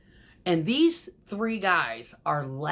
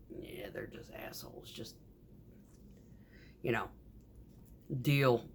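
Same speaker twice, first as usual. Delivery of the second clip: hushed, secretive whisper; whispered aside; 3 semitones lower, 7 dB quieter than usual. Retold or imitated. The boss confiding about the finishers, in a whisper. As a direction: lean in and whisper it.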